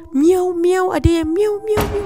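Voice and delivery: lightly voiced ghostly cat meow; imitating a ghost's meow. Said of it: "berlainan pitch".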